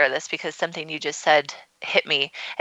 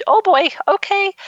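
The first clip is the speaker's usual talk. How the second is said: much higher, louder flustered man imitation; alarmed husband; 5 dB louder than usual. Imitating Justin reacting to his wife in tears before the show, higher and louder. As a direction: mimic a startled partner going oh boy. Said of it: "poor Justin was just like".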